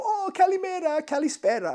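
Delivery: very high, loud friendly kalimera, kalispera; high, loud delighted greeting with correction; cheery greeting. Retold or imitated. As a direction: sing out the greetings like a happy local. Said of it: "They might go".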